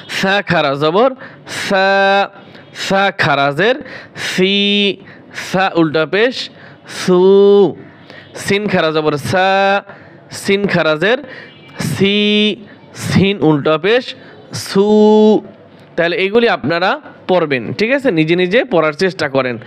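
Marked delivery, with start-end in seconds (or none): 0.1-5.0 সা খারা জবর সা spelled very slowly, vowels held long; a teacher stretches the standing fatha for students; slow drawn spelling. Shown as a demonstration. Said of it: "একালিফ টানিয়া পড়িতে হয়". 5.3-15.4 spelled very slowly with long held vowels; a teacher drills the inverted damma slowly; slow drawn spelling. Shown as a demonstration. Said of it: "একালিফ টানিয়া পড়িতে হয়".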